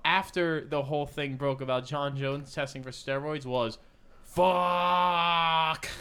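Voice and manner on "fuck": loud, higher, held on one steady note; long drawn-out expletive. Acted. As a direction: stretch the swear out into one long mock cry. Acting out the man's first tweet.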